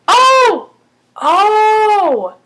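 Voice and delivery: loud, high drawn-out oh; real startled revelation; sudden realisation. Real, not acted. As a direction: exclaim as it dawns on you. Sudden realisation mid-recap.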